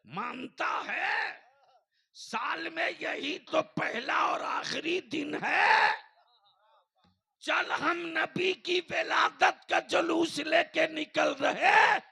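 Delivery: very high, shouted rallying cry; rally the crowd at the top of your voice; full-force preaching. Real, not acted. (जोशीली, ललकारती ऊँची तक़रीर)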